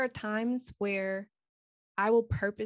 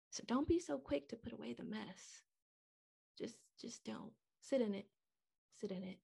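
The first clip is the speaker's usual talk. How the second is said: very soft, near-whispered plea; hushed urging; 13 dB quieter than usual. Real, not acted. Speaker gently advises listeners to sit in their mess, hushed. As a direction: let the voice drop almost to a whisper.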